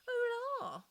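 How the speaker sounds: high, loud bitter exclaim. A narrator's reading. Oak answering the mocking plants, high bitter exclamation. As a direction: spit it out with bitter scorn. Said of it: "replied the oak bitterly".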